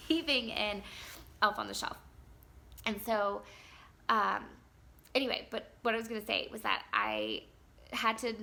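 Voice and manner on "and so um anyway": usual speaking voice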